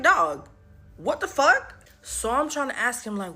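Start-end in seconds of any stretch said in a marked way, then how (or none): 0.0-1.8 loud, rising incredulous outburst; shocked disbelief. Retold or imitated. Friend learns she's still there, loud disbelief. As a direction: blurt it out, pitch shooting up. Reacts to bitch being there.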